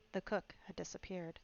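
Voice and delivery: ordinary delivery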